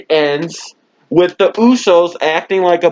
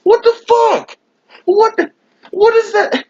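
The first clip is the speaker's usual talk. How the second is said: high-pitched cry of disbelief, much higher; shocked outburst. Real, not acted. Fan ranting to camera about the ending, high shocked outburst. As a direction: squeal it out in outraged shock. Outraged at the match finish.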